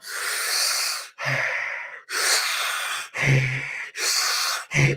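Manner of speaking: long, repeated noisy huffs from a bag; imitated sniffing. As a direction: act out huffing glue in drawn breaths. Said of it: "he starts sniffing".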